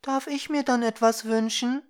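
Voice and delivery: put-on high, small girl's voice; delicate little voice. A narrator's reading. Little girl asking the two for a wish, high and delicate. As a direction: ask sweetly in a high child voice. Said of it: "fragte eine zarte Stimme".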